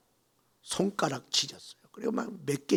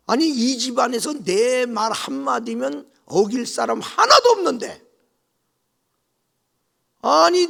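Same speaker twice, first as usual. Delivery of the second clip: much higher, louder enraged matriarch yelling; furious outburst; 10 semitones higher, 9 dB louder than usual. Retold or imitated. Mother-in-law raging that the bride defied her, furiously. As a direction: blow up at her.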